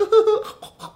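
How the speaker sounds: high, loud fake sobs; mock crying while eating. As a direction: blubber through a mouthful of hamburger. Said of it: "half crying, half eating the hamburger".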